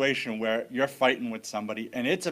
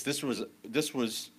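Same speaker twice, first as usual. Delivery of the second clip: ordinary delivery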